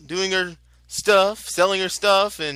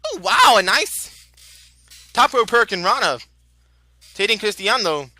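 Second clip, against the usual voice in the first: excited; 2 semitones higher, 3 dB louder than usual. Real, not acted. Excited call of a big move.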